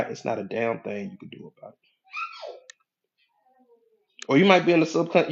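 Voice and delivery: normal talking voice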